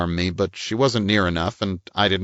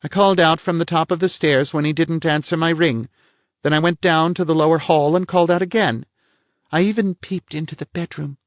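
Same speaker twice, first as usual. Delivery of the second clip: a higher-voiced prim society lady; lady's voice, pitched up and a little pert; voiced woman; 10 semitones higher than usual. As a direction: play the indignant lady of the house. Woman figure's lines.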